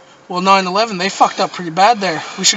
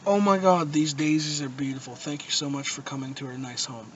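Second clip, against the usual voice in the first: softer, lower, dainty hostess voice; sweet polite English; 5 semitones lower, 10 dB quieter than usual. Shown as a demonstration. Contrasting polite English with aggressive German, soft and dainty. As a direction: coo it politely like a gracious host.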